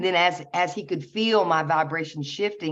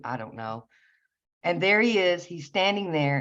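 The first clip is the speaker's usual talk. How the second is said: ordinary delivery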